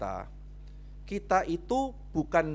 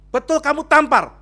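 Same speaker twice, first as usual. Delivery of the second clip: loud, high-pitched accusing question; acted interrogation; 12 semitones higher, 14 dB louder than usual. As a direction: fire the accusation at full volume. Acted pastor interrogates the husband.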